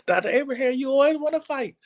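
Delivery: high, teasing mock voice of a critic; a lightly voiced nagging critic. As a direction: poke fun at the people who say it. Mocking what critics say.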